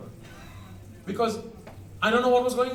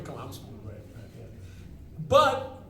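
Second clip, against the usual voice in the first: ordinary delivery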